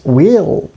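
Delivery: "will" with a long ee vowel, like "weel". Shown as a demonstration. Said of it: "the pronunciation will be like this".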